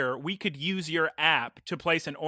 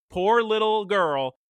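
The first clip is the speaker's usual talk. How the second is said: ordinary talk, nothing marked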